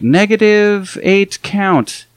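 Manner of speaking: "negative eight count" dragged out very slowly, each word pressed; hinting emphasis. Retold or imitated. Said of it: "trying to hint".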